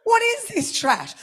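high, slow, drawn-out disbelief; mock disgust. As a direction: ham up your horror at the game. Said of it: "and I'm like".

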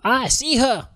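high, hammy mock German; bad German accent. Soldier spotting her on the battlefield, mock German accent. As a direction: squeak it in a put-on German accent. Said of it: "That is my German accent".

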